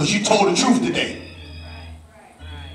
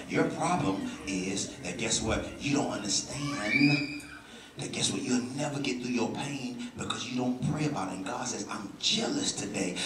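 much softer, lower, intimate talk; sincere quiet; 12 semitones lower, 13 dB quieter than usual. Real, not acted. Pastor stepping back to speak sincerely to the room, soft. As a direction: lean in and speak it plainly to them. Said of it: "try to say this without, with all sincerity".

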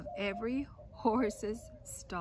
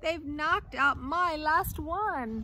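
higher, louder, slow dismayed wail; 6 semitones higher, 8 dB louder, much slower than usual. Acted. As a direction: wail over the last lost tooth. Teeth knocked out.

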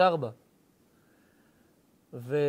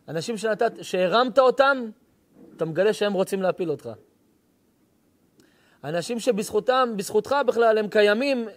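rising sermon fervor, louder and higher; fiery preaching; 5 semitones higher, 6 dB louder than usual. Acted. Preacher rousing the congregation about betrayal, fiery preaching. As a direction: preach it loud and high, driving each phrase. Preacher builds to passionate climax.

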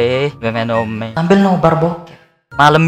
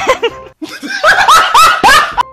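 shrieking laugh. Acted. Loud laugh clip.

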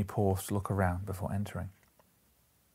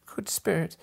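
pleading; 9 semitones higher than usual. A narrator's reading. Scrooge falls before spirit.

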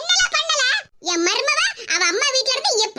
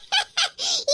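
soft snickering laugh; mocking giggle; 4 semitones higher, 4 dB quieter than usual. Daughter-in-law snickers at the furious mother-in-law, soft mocking giggle. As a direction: snicker quietly at her fury.

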